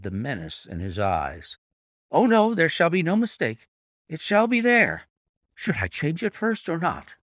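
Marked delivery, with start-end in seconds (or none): 2.1-7.1 high, anxious eager pleading; cowed trainer anxiously assuring Holmes; cringing fear. A narrator's reading. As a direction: cower and promise too much.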